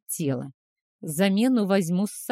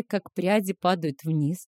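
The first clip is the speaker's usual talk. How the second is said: usual speaking voice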